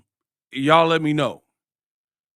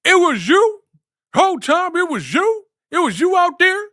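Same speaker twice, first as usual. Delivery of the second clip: very high, loud shocked questions; 14 semitones higher, 4 dB louder than usual. Retold or imitated. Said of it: "Full extra".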